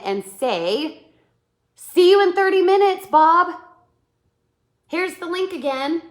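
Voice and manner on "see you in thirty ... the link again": high, loud, energetic greeting; passion and excitement. Acted. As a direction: greet Bob bursting with energy. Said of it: "Passion, energy, and excitement".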